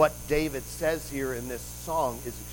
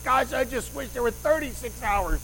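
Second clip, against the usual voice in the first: higher-pitched mock wishing; mock wishful complaint; 8 semitones higher than usual. Preacher mimics listeners wishing for longer days, mock wishful. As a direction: lightly imitate someone wishing for more time.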